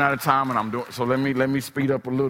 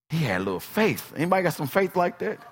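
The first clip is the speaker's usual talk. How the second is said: ordinary talk, nothing marked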